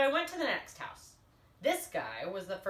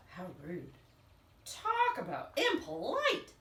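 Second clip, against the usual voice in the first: indignant; 3 semitones lower than usual. Acted. Wolf indignant.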